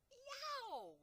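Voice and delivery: high, loud yelp of pain; roar. A narrator's reading. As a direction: howl it out like a tormented giant. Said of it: "roared the flesh lump eater".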